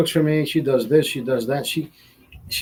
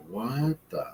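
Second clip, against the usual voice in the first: stunned; 6 semitones lower, 12 dB quieter, much slower than usual. Retold or imitated. Shocked whisper.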